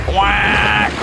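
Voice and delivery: high, loud duck squawk; squawking quack. Acted. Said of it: "squawked the ducks".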